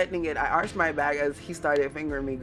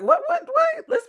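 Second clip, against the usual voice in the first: squealing laugh; 7 dB louder than usual. Real, not acted. High laughing disbelief.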